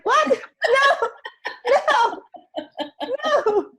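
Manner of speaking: laughing panicked refusal; horrified protest. Retold or imitated. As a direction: protest the photo, laughing in dismay. Refusing a photo after crying.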